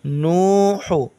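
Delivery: नू हू with both vowels stretched long; slow Quran reading drill; drawn-out vowels. Shown as a demonstration. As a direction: hold the ऊ in नू and हू slowly. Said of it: "नून वाव को खींचेंगे".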